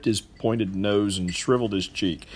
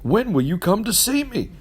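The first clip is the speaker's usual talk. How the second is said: a bright, higher friendly hail; cheery, higher, welcoming call; gladsome greeting; 11 semitones higher than usual. Acted. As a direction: greet him like a dear old friend in the street. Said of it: "to say with gladsome looks".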